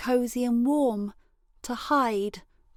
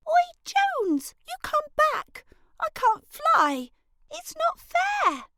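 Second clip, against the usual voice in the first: much higher, louder wailing protest; upset call; 5 dB louder than usual. Acted. Girl left in the tree shouts after Jones, upset. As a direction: cry out after him, it's unfair.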